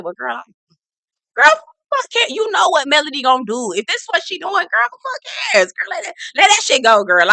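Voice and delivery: put-on high, breezy girl-talk voice; mock castmate. Mimicking a castmate brushing off the drama. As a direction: toss it off like nothing matters.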